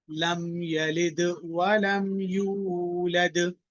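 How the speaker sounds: slow, melodic tajweed recitation with a long held final vowel; measured chanting recitation, the last word drawn out; Quranic recitation. Shown as a demonstration. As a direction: recite it slowly for the class to echo. Teacher recites for class to repeat.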